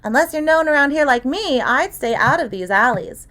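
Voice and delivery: put-on girl's voice, knowing and dry; lightly voiced young girl. A narrator's reading. Girl in the prop room teasing the detective, lightly voiced.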